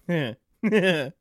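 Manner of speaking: put-on snicker building to high laughter; chuckle, then crack up as if tickled; acted amused chuckle. Gamer reacting to crude lobby talk, acted snickering laugh. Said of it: "I, on the other hand, would be like".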